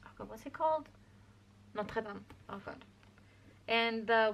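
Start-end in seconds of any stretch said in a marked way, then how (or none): none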